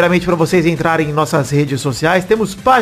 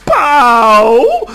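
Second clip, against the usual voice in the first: punchy shout; 6 semitones higher, 6 dB louder than usual. Acted. Punchline shout after brand name.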